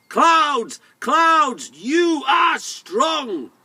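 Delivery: high, loud shout to the sky; big storybook shout; calling out. Acted. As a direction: call up to the clouds, stretching the last words. Tom calls up to the clouds.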